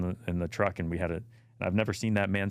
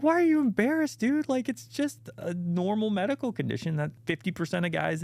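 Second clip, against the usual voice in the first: much higher and louder, disbelieving; as if you can't believe he's ashamed; incredulous; 13 semitones higher, 3 dB louder than usual. Retold or imitated. Telling embarrassed friend ED is normal, high incredulous disbelief.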